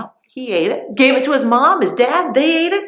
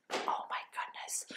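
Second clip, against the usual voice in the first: a hushed, horrified aside; awed whisper; 20 dB quieter than usual. Real, not acted. Storyteller whispering to the children in shock.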